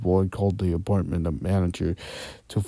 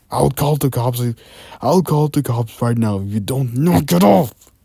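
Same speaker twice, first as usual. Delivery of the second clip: angry shouting; 5 semitones higher, 7 dB louder than usual. Acted. David threatening neighbours.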